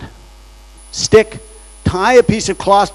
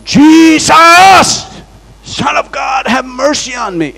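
loud, high desperate cry; crying louder; 8 semitones higher, 4 dB louder, slower than usual. Retold or imitated. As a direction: cry out even louder for mercy. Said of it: "the blind man cried louder".

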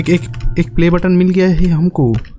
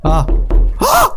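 high shrieking jump-scare scream; real startled scream; 14 semitones higher than usual. Real, not acted. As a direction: shriek in sudden fright. Jump scare in horror game.

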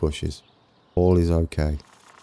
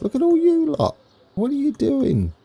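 high squeaky voice. Real, not acted. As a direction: voice jumps way up, squeaky. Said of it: "You make my voice go high".

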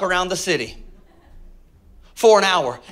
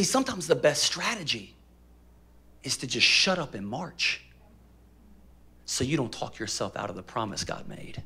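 ordinary delivery

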